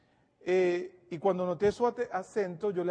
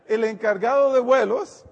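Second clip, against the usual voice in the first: louder, sure restatement; 4 semitones higher, 9 dB louder than usual. Real, not acted. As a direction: say "el encargado de vuelos" loud and firm. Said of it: "¿ok?".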